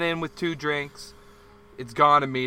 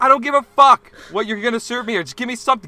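much higher, much louder tirade; retold furious rant; 9 semitones higher, 10 dB louder than usual. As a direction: rage at him across the counter.

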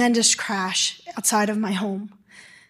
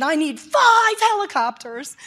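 raised pitch, stretched emphasis on five; retold urgent demand; 5 semitones higher than usual. Doctor as medical command on the phone, retold urgent demand. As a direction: re-enact demanding emergency help.

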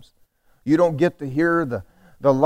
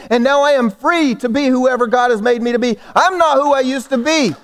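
raised, driving sermon declaration; full-force preaching; 8 semitones higher, 6 dB louder than usual. Real, not acted. Preacher testifying to the congregation about being changed, proclaiming forcefully. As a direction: build it higher, proclaiming each line.

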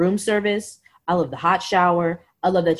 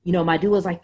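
ordinary talk

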